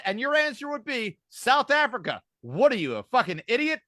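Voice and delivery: ordinary talk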